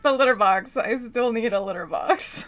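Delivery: loud, insistent demand as a cat. Pretend. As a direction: wail it like a needy pet. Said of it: "he's like".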